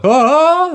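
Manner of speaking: high, loud mock wail; imitated panicked yelp. Snowboarder mimicking scared beginners on the slope, high panicked yelp. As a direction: let out a long, startled cry like a tense first-timer. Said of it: "noises you never heard before".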